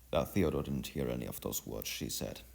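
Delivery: plain reading voice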